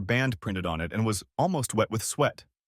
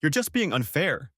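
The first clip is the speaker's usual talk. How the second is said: whined; 6 semitones higher than usual. A narrator's reading. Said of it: "she whined".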